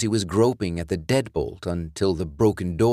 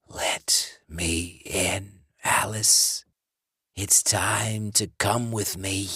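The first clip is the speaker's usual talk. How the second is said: slow, breathy rasping whisper of a corpse; undead John; slower than usual. Acted. Dead ex-boyfriend luring Alice, slow rasping whisper. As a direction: hiss it through the door like a dead man.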